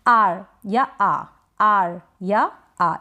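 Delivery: "आर या आ" धीरे, आर में र बस ज़रा सा; "आर या आ" में दोनों रूप अलग-अलग, लंबे आ के साथ; हल्का र. Shown as a demonstration. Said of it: "र का उच्चारण बिल्कुल थोड़ा सा करें". ("आर या आ" को सिखाने के लिए खींचकर, र हल्का)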